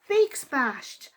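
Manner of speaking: usual speaking voice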